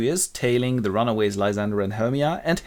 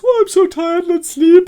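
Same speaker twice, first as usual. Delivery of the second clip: very high, loud mock-tired whine; weary Hermia; 10 dB louder than usual. Hermia begging Lysander to rest, weary. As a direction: wail the tiredness at high pitch. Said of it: "and she goes".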